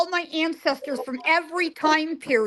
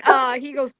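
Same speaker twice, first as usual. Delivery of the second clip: normal talking voice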